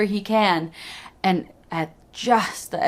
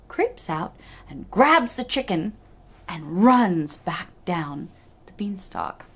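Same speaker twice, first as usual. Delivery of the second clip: usual speaking voice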